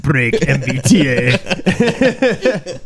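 very slow Russian boxer-villain voice; Dolph Lundgren. Retold or imitated. Friend parodying the train woman as movie villain, slow mock Russian. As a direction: stretch it out in a menacing mock Russian accent. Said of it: "Yeah, she was Dolph Lundgren from, uh, Rocky Five".

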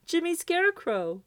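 high, small scarecrow voice. A narrator's reading. Scarecrow answers Santa from the dark, high voice.

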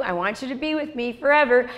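usual speaking voice

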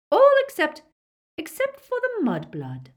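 high, loud start, easing into contempt; sharp. A narrator's reading. Bellatrix picks out one prisoner, sharp high order. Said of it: "said Bellatrix sharply".

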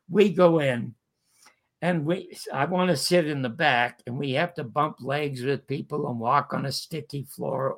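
usual speaking voice

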